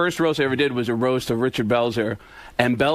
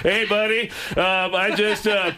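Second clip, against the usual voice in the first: higher-pitched celebrity impression; rambling, pitched-up put-on voice; Tom Arnold; 6 semitones higher than usual. Shown as a demonstration. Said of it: "Just do your Tom Arnold".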